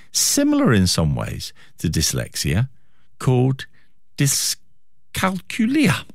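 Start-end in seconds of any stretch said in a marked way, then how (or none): 4.2-6.0 dyscalculia said slowly and carefully, stress on "cal", ending "-cu-li-a"; unsure pronunciation. Real, not acted. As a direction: sound out dyscalculia slowly, testing each syllable. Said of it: "Or is it, is that how you pronounce it?".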